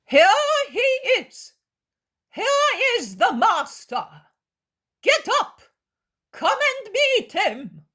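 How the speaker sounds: high excited calls to come; shouting. Acted. Scribes calling out that the master has arrived, shouting. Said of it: "shout the scribes".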